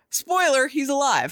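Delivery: ordinary talk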